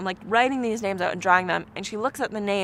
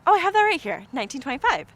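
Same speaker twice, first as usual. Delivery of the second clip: high, louder eager reply; retold eager answer, pitched up; 7 semitones higher, 5 dB louder, faster than usual. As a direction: chirp it out, pleased to help. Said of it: "And I was like".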